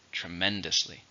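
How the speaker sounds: "Tremendously" stressed on MEN; stress on second syllable. Shown as a demonstration. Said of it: "stresses on the second".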